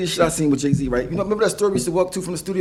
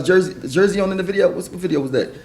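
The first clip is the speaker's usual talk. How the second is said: ordinary talk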